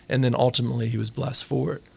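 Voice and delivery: ordinary talk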